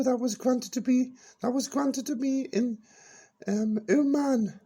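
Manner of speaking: put-on high, bright-eyed old dear reminiscing; high character voice Peachy. Old character reminiscing to the radio host, high voice.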